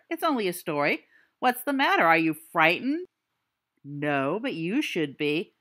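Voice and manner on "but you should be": ordinary talk, nothing marked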